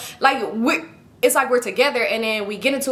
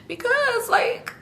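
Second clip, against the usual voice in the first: tearful; 13 semitones higher, much slower than usual. Retold or imitated. Woman answering her man through tears, high tearful voice. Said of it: "I'm gonna talk regular".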